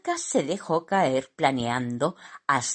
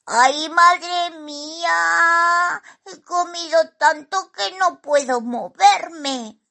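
high, drawn-out squeaky wail; hammed-up high-pitched whining; comic lament; 13 semitones higher, 5 dB louder than usual. A narrator's reading. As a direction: moan in a tiny overstuffed voice. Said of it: "mientras se lamentaba".

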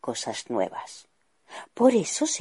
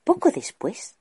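ordinary delivery, nothing marked

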